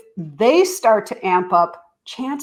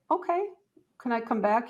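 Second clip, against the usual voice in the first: gentle parent; 3 semitones higher, 8 dB quieter than usual. Retold or imitated. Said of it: "So you might need to say".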